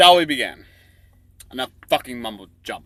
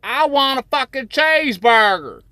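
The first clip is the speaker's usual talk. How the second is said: much higher, loud shouted woman's demand; patient on the operating table yelling for a burger, much higher and louder; 13 semitones higher, 7 dB louder than usual. Acted. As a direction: shriek it high like a greedy patient. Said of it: "She says".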